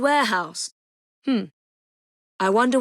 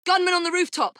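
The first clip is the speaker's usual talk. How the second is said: raised, urgent call out; warning shout; 6 semitones higher than usual. Acted. Ally warns of a gunman, shouted.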